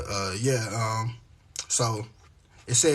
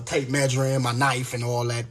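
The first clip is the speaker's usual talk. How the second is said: ordinary talk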